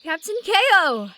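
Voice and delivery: bright shouted greeting; cheerful call. Acted. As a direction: call out jovially to the captain. Greeting a boat captain.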